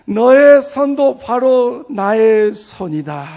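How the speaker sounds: loud, slow divine proclamation; Lord's voice. Retold or imitated. The Lord claims the pastor's hand, loud slow proclamation. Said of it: "그 주님의 음성을 듣고".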